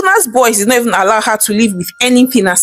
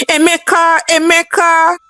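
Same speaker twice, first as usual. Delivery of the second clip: shouting a name; 8 semitones higher than usual. Acted. Mother calls her son's name on the phone, high-pitched. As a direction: cry his name down the phone. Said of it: "Madam Ezinne shouted".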